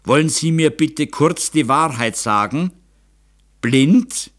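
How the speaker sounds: high, loud demand for the truth; insistent, ending on a loud rising word; sharp questioning. A narrator's reading. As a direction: cut through with a pointed question. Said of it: "mit der scharfen Frage".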